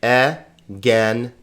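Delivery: "E-gen-e-ta" slowly in four separate syllables. Shown as a demonstration. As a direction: sound out "E-gen-e-ta" with gaps between. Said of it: "Here's a four-syllable word".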